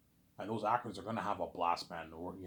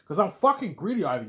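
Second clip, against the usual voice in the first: loud, high-pitched comeback; defiant retort; 8 semitones higher, 9 dB louder than usual. Acted. Peter admits his greed to Ivan, loud defiant retort. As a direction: throw it back at him, loud and high.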